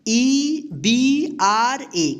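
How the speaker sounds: letters spelled out very slowly; slow spelling. Shown as a demonstration. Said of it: "क्या होगा?". (धीमी spelling)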